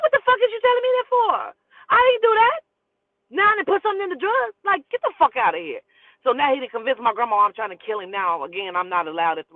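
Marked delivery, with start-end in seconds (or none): 0.0-5.8 high, loud, furious protest; outraged shout. Retold or imitated. Daughter accused of spiking the drugs, shouting in outrage. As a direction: yell it in outrage, very high, easing slightly by the end. Acted quarrel, accused of poisoning daddy.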